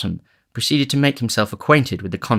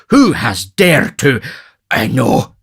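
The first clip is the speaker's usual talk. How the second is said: loud, slow, higher-pitched outrage; indignant; 4 semitones higher, 7 dB louder, slower than usual. A narrator's reading. Beadle wants whoever upset the matron, loud indignation. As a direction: demand it with pompous indignation.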